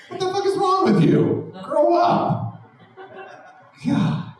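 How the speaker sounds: hammed-up outrage at the idiot; mock road rage. Comic ranting at the police car driver, mock road rage.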